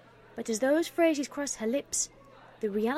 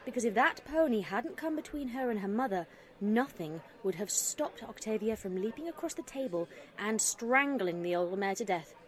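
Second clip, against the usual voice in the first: plain reading voice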